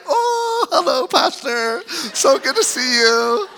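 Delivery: high, bright syrupy greeting; hammed-up fake-nice greeting to the pastor; fake sweetness. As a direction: switch instantly to sugary politeness. Sudden fake sweetness at church.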